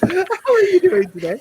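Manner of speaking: very high-pitched mock-polite hello; squeaky-high second try at a welcome; redone greeting. Shown as a demonstration. Let's try that again.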